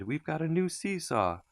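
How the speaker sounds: put-on high, eager children's voices; gleeful over a new seesaw; children crowding round, thrilled; excited cry. A narrator's reading. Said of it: "they cried".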